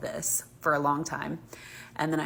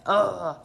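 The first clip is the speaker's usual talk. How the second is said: disgusted ugh; 4 semitones higher, 7 dB louder than usual. Real, not acted. Disgust at the memory.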